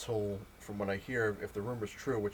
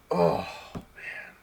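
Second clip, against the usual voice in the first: whispered sigh; 6 semitones higher, 8 dB louder than usual. Real, not acted. Despair over the comic price.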